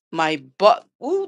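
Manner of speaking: ordinary talk, nothing marked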